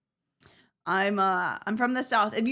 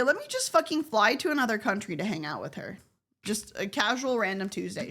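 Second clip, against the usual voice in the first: usual speaking voice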